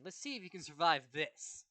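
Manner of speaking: shouted, cocky challenge; loud taunt. Acted. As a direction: yell the taunt at him. Bakugo's battle taunt.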